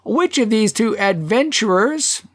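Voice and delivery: high, indignant declaiming; tanner pressing on. A narrator's reading. Tanner tries another name for the pair, high oratory. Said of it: "The tanner".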